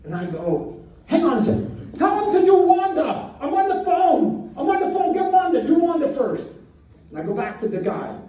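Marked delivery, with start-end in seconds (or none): 1.0-6.5 high, loud, urgent holler; yelling. Retold or imitated. Man on a lawyer call yelling aside about Wanda, high-pitched yell. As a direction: shout it high from the phone. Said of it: "I'm yelling at a lawyer".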